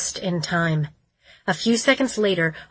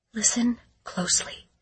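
whispering; 4 semitones higher, 5 dB quieter than usual. Acted. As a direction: breathe it out as a warning. Whispered warning in dangerous woods.

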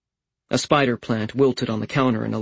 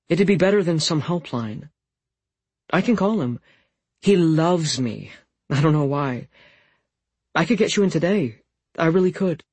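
put-on higher, eager, pleading young woman; 6 semitones higher than usual. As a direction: push the offer, gently insistent. Voices Fiona pleading.

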